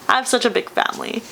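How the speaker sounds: really choking up with feeling; tearful, emotional. Real, not acted. Telling viewers how much family and this news mean, tearfully emotional. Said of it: "sorry, I'm getting emotional".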